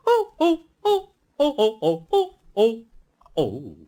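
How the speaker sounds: high, loud startled cries; a chorus of silly trucks in a fluster; squealing trucks. A narrator's reading. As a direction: yelp like trucks bumping together. Trucks bump into each other.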